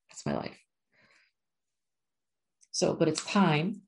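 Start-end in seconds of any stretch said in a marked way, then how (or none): 0.1-0.6 breathy laughing whisper of the same words; half-laughing whispered confession; whispered aside. Real, not acted. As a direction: drop to a wry whisper. Repeats own line as whisper aside.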